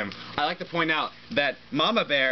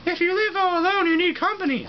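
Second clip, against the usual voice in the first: much higher, louder lady bear voice; reading the lady bear's line in a high voice; 4 dB louder than usual. Acted.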